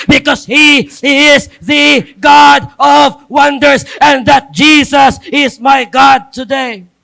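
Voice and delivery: fervent pulpit crescendo; preaching at full force. Acted. Preaching build.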